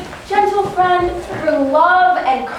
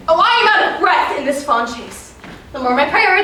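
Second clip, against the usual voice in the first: ordinary talk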